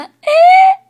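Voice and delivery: very high, loud squeal; overexcited shriek of glee; delighted squeal. Acted. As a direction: burst into an ear-piercing giddy squeal. Colt squeals after gushing.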